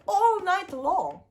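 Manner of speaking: ordinary delivery, nothing marked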